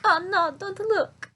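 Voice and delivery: high, loud, slow hammed-up plea; mock distressed woman. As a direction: wail it in a high mock-accented voice. Acted distressed woman hiding herself.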